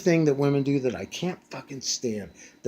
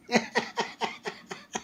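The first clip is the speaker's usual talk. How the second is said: high breathy laugh; 7 dB quieter than usual. Real, not acted. Laughs at his own joke.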